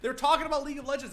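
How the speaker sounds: high, loud, fast, laughing through it; gushing while still cracking up; laughing excitedly. Real, not acted. To chat, gushing about Merry and Pippin, laughing fast.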